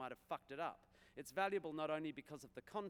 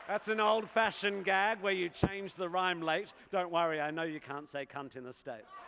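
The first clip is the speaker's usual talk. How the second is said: loud aside; 5 semitones higher, 13 dB louder than usual. Acted.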